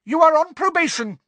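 high, loud shriek. A narrator's reading. Umbridge shrieking at Snape, loud and high. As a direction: shriek the threat at him. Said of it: "shrieked Professor Umbridge".

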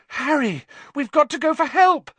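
high, loud panicked cry; frightened cry. A narrator's reading. Hermione to Harry as Ron vanishes, panicked high cry. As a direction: cry out for help in terror. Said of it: "Hermione cried".

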